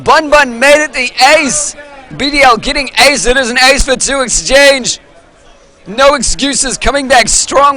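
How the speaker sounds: high, loud, fast esports casting; live play-by-play. Acted. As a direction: call the fight like a hyped caster.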